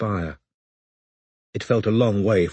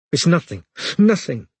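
higher, louder fierce denial; savage; 8 semitones higher, 4 dB louder than usual. A narrator's reading. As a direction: snap it out through tears. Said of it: "said Robin savagely".

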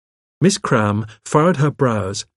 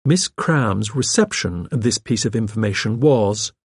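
usual speaking voice

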